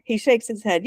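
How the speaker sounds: usual speaking voice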